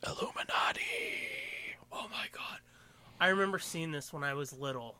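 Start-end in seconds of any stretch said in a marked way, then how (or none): 0.0-2.6 hushed conspiratorial whisper. Pretend. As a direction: breathe it like a secret.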